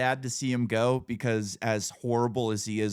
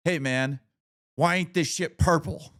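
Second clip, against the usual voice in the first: higher, louder mock-indignant bar customer; 6 semitones higher, 4 dB louder than usual. Bartender retells a customer complaining about his drink, higher, louder mock indignation. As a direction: play up his outrage at the drink.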